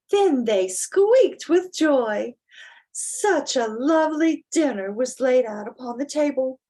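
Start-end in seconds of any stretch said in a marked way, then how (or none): none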